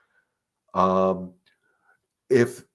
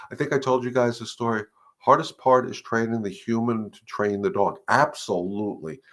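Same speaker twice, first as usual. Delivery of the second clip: ordinary delivery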